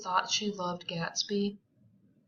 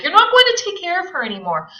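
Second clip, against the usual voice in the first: high, fast panicked outburst; 10 semitones higher, 12 dB louder, much faster than usual. Acted. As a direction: rush it out frantically. Gatsby panicked continuing.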